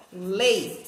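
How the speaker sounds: "lei" drawn out slowly on its own; single stretched "lei"; isolated syllable. Shown as a demonstration. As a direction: hold "lei" slow and low as the strong syllable. Teacher isolates the strong syllable.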